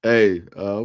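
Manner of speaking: usual speaking voice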